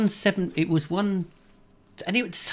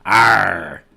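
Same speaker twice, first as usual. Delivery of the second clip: loud, hammed-up pirate "arr"; 5 semitones lower, 9 dB louder than usual. Host answering own pirate joke, loud pirate voice. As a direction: belt out a long mock pirate roar. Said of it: "what do pirates do".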